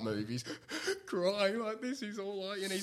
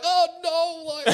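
high, laughing oh no; hammed-up startled cry; mock panic; 6 dB louder than usual. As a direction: squeal it in fake alarm. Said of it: "And I'm like".

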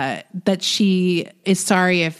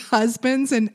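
ordinary delivery

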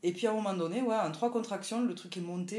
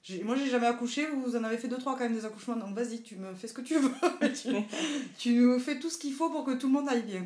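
laughing; 6 semitones higher, 6 dB louder than usual. Retold or imitated. Retelling words to the doctor.

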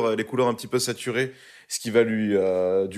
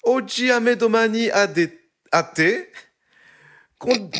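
put-on over-the-top Italian accent, pitched much higher and slowed; hammed-up Italian; 11 semitones higher, 5 dB louder, slower than usual. Asked by friends to say the titles in Italian, hammed-up Italian accent. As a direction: ham up the Italian titles like a proud native, then lose your nerve on commandamenti. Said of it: "je peux le faire si vous voulez".

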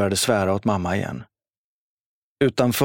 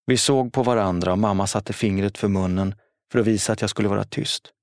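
usual speaking voice

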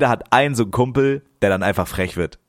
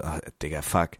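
soft, dropped-away groan of dread; muttered dismay; 8 semitones lower, 8 dB quieter than usual. Retold or imitated. Retelling the crowd forming around them, quiet dread. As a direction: sink into a quiet oh-no.

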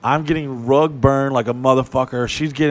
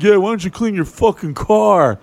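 louder, higher mocking dig in a put-on voice; roasting jab; 6 semitones higher, 5 dB louder than usual. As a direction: needle him like a smug roommate. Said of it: "he's roasting me the whole entire time".

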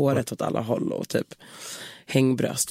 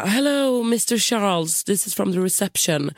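high, slow mock receptionist with a Spanish accent; 5 semitones higher than usual. Receptionist calling the guest at dawn, Spanish-accented English. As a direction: play the polite hotel receptionist with a Spanish lilt. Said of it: "han har så här lite spansk brytning".

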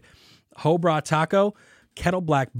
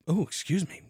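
talking through a burp; words broken by a burp; 4 semitones higher than usual. Real, not acted. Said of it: "burp over there".

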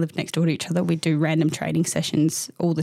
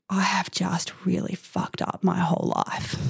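slow whispered despair; 3 dB quieter, slower than usual. Retold or imitated. Mother with newborn thinking to herself, slow whisper. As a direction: breathe it out hopelessly, slowly. Retold despairing inner thought.

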